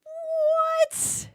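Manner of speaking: high, stunned yelp of disbelief; shocked what. Real, not acted. Streamer reacting to race call, stunned high yelp. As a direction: shriek it in utter shock.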